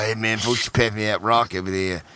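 low, slurred addict voice; crackhead voice. Pretend. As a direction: mumble like a twitchy addict. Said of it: "we're gonna do crackhead voices".

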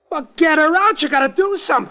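begging in panic; panicked plea. Acted. Harry begs Stone to save Peggy, panicked.

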